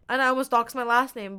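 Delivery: ordinary delivery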